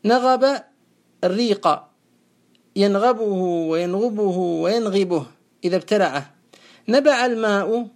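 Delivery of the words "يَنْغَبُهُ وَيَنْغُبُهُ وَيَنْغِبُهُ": ينغبه وينغبه وينغبه said yanghabuhu, yanghubuhu, yanghibuh; vary the vowel after gh in ينغبه: a, u, i; three vowel forms. Shown as a demonstration. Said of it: "بالفتح والكسر والضم".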